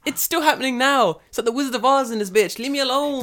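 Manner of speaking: very high, loud panicky squeal; shrill-free high mock panic; mock hysterical yelp.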